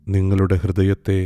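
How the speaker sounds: ordinary talk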